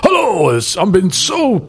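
a playful hint of a famous actor's voice; Charlton Heston. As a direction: lightly mimic a booming star greeting a newcomer. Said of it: "Charlton Heston comes up and he goes".

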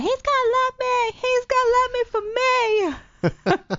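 very high, slow, laughing squeak; high squeaky voice. Retold or imitated. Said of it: "and I was like".

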